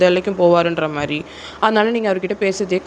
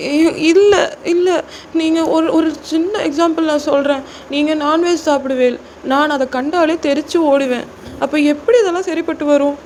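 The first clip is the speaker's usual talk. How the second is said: high-pitched, anxious protest; flustered junior girl; 9 semitones higher than usual. Acted. Junior girl explaining to senior why it won't work, flustered. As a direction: argue pleadingly in a high girl's voice.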